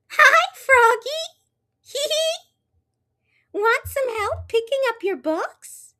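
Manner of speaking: put-on very high, sweet girly voice with giggle; Froglina.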